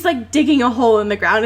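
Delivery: ordinary delivery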